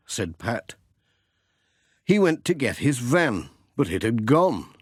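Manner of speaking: plain reading voice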